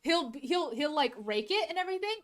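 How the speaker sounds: ordinary talk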